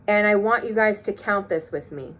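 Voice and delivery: ordinary talk, nothing marked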